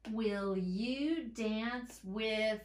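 each word stretched, very slow; slow teacher dictation; very slow reading. Shown as a demonstration. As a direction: sound it out for the class. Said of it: "anticipate my final word".

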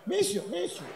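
high, soft little kitty-call; calling an animal. Acted. Trying to call an elephant, calling it softly and high. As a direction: coax it over in a small high voice.

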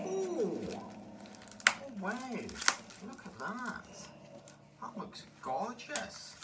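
high, excited Scottish exclamations; delighted Scottish lilt, slow on the last line; Scottish accent. Real, not acted. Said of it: "why have we gone into a Scottish accent?".

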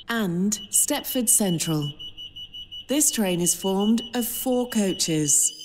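ordinary talk, nothing marked

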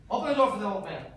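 normal talking voice

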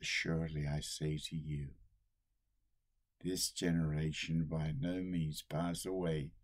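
ordinary talk, nothing marked

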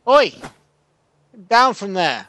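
loud, high outraged yell; indignant shouting. Real, not acted. Player shouting at the cheating cow, indignant.

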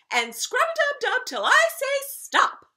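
very high, loud command; bossy nanny. A narrator's reading. Nanny sending the princess to bathe, bossy commanding. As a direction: order a child about in a high, loud voice. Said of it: "the nanny would say".

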